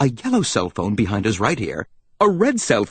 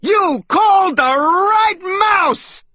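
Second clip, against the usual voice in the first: high, slow triumphant holler; big cartoon salesmouse shout; loud voice; 3 dB louder, slower than usual. A narrator's reading. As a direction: shout it like a brash salesman, stretching each word. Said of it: "in a loud voice".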